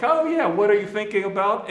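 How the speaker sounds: high, loud, offhand mock question; casual question. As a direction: toss it out as if it were no big deal, rising at the end. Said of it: "not just, like, any old rebuke".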